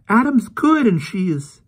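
put-on high, loud, slow girl near tears; tearful girl. Polly in tears arguing with the canon, high slow girl. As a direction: plead through rising tears.

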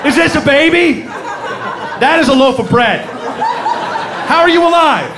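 high, goofy dog voice; eager oblivious Dalmatian. Acted. Dog back from the burning house, high and clueless.

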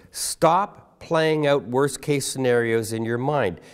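ordinary talk

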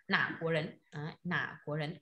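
"nả cúa rấn" said softly and carefully, without curling the tongue; model phrase. Shown as a demonstration.

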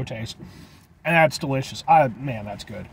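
chewing while talking; muffled by food; mouth full. Real, not acted. Seen 0: Bite at -1.0, then mouth working with burger held close.

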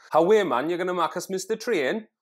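put-on high, lilting Northeast English accent; Geordie lilt. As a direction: sing it up in a Sunderland lilt. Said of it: "accents from the northeast of England often have a bit of a lilt".

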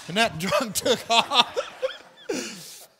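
loud, drawn-out line breaking into a laugh; laughing punchline. Real, not acted. Preacher tells how the drunk fled the demon, laughing loudly. Comic climax of the drunk fleeing.

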